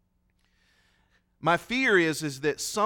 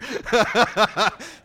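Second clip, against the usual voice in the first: loud, high burst of laughter; 6 semitones higher, 6 dB louder than usual. Real, not acted. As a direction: laugh out loud at your own teasing. Joking aside to Reuben.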